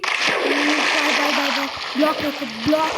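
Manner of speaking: loud frantic byes while fleeing; panicked yelling. Real, not acted. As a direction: yell bye over and over as you run. (घबराकर ज़ोर से चिल्लाना)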